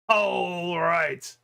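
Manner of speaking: ordinary delivery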